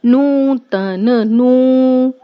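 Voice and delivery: नूतन said slowly with the ऊ held; slow it down and hold the ऊ in नूतन; stretched long oo. Shown as a demonstration. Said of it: "किसकी ध्वनि सुनाई पड़ी".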